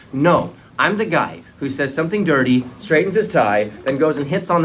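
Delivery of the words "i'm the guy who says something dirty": ordinary talk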